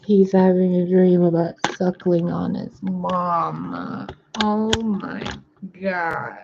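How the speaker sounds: slow, high, tender coo. Real, not acted. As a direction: melt over the sleeping little one.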